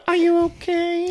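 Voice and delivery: high, slow fake concern; mock caring wife voice. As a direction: drawl it like a doting wife.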